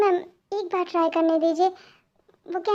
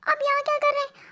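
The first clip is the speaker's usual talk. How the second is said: much higher, startled squeak of a question; 9 semitones higher than usual. Acted. Daughter startled to find her secret love in her room, high surprised cry. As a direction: blurt it out in shock.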